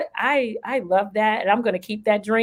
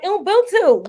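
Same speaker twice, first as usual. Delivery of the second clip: high little-kid imitation; lightly voiced small child, pitched up; imitating a three-year-old; 8 semitones higher, slower than usual. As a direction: say it like a proud toddler. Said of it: "this little three-year-old looked at me".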